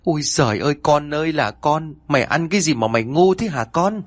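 kêu trời như người mẹ tuyệt vọng; gào khóc. A narrator's reading. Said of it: "ngẩng mặt lên trời mà gào khóc". (bà mẹ than trời vì con, giọng cao nghẹn ngào)

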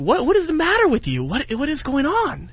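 put-on high falsetto girlfriend voice, falsely worried; mock concerned girlfriend. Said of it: "just ever so concerned".

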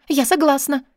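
high, eager assent; bright-eyed, high-pitched yes; joyful agreement. A narrator's reading. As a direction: jump in gladly before the offer is finished. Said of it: "закивала она радостно".

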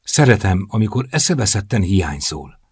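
usual speaking voice

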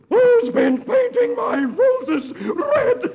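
slow, very high screeched roar; furious roar. Acted. Queen of Hearts catches rose painters, roaring shriek. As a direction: roar each word, high, loud and drawn out. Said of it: "the Queen roars".

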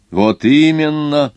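anxious exclamation. A narrator's reading. Watchmaker confirms the passengers' fears, anxious exclamation. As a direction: exclaim it with a touch of alarm. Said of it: "воскликнул часовщик с тревогой".

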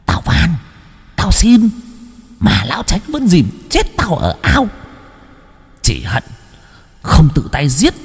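high, eerie hissing ghost; vengeful ghost. A narrator's reading. Drowned ghost curses the family, high and vengeful. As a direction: spit out the grudge through teeth. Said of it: "nghiến răng".

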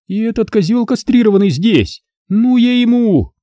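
high, gloating taunts; openly mocking Alisa. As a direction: jeer at him, delighting in it. Alisa threatening.

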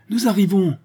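lancer bien plus aigu pour être entendue; appel crié. A narrator's reading. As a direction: crier vers la maison en courant. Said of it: "proféra Honorine". (cri d'appel, voix bien plus haute)

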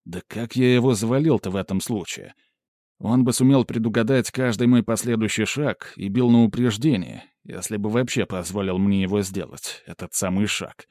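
usual speaking voice

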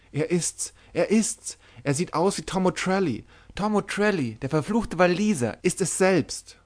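high, frightened ghost-sighting cry; terrified. A narrator's reading. A sailor sees the dead Welshman's ghost, terrified high cry. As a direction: shriek as if seeing the dead.

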